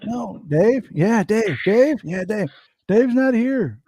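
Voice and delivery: high, loud mock stoner repeating Dave. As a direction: ham up the stoned guy at the door. Acting out the Dave's not here bit.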